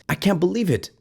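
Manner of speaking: high, astonished I can't believe it; disbelief. Real, not acted. Fan stunned by the win, high astonished disbelief. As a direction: gasp it out, caught off guard.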